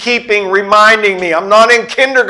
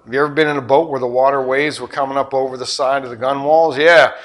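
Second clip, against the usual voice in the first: ordinary talk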